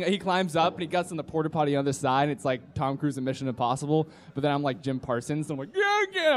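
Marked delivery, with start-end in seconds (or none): 5.8-6.3 very high comic squeal; hammed-up helpless climber; mock struggling. Clumsy climber struggling on the wire, comic high squeal. Imitates himself climbing feebly.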